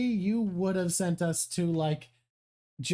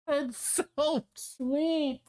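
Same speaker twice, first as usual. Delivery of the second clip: ordinary talk, nothing marked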